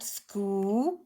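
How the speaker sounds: "sku" said softly and clipped as one blend; blend sounded out. Shown as a demonstration. Teacher sounding out the blend for pupils, soft. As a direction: sound out "sku" as a single chunk. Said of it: "three-letter blend at the front".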